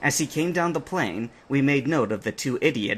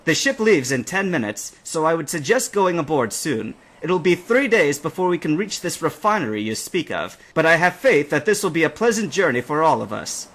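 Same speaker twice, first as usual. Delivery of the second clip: higher, louder hearty greeting; captain's greeting; 5 semitones higher, 5 dB louder than usual. A narrator's reading. The captain welcoming the trainers, hearty greeting. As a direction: call it out warmly.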